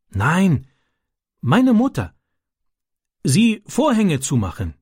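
put-on high-pitched, halting boy in broken German; Ram, a foreign boy. A narrator's reading. Foreign boy telling his friend about the gang, high and halting.

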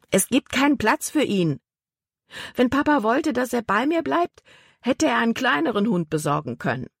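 high-pitched indignant reply; irritated retort. A narrator's reading. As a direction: snap back, pitch up. Libby spun round, irritated. (empört)

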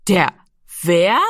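halting echo, rising on the question; incredulous. A narrator's reading. Giro cannot believe Rami's answer, incredulous. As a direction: repeat it as if you misheard. Brother scoffs at boy's answer.

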